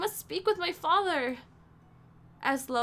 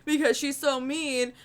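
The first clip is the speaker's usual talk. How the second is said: mock quote; 6 dB louder, slower than usual. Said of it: "that was just like".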